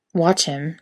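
ordinary delivery, nothing marked

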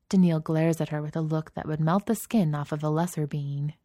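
plain reading voice

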